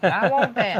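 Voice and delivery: high, loud mock baby demand; pretend baby. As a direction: squeal it like a hammed-up bawling infant. Imagines newborn at yard sale.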